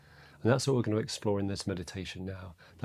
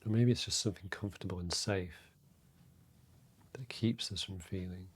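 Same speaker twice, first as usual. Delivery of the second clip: guided meditation; 6 dB quieter than usual. Real, not acted. Meditation teacher to listeners settling into their breath, soft and calm. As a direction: guide them inward quietly, leaving space between phrases.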